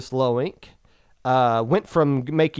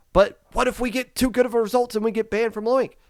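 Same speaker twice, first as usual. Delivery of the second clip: higher, fast whiny question; whiny team voice; 8 semitones higher, faster than usual. Retold or imitated. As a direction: play the worried player. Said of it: "voicing a worried team".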